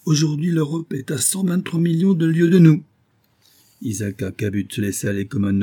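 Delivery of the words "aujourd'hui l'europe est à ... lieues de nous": high-pitched, exultant proclamation; triumphant announcement. A narrator's reading. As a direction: deliver the astonishing fact to silence them. Professor triumphantly announces the distance.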